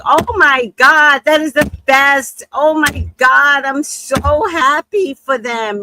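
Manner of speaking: loud, high, drawn-out gushing; thrilled congratulations, big and slow; overjoyed reaction. Retold or imitated. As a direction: gush with delight, stretching the words. Reacting joyfully to wedding news.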